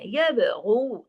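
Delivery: यब गू drawn out slowly, heavy ग़ैन; slow recitation demo. Shown as a demonstration. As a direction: stretch यब गू with a thick throaty ग़ैन. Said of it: "अब गैन को मोटा करना है". (यब गू धीरे, ग़ैन मोटा)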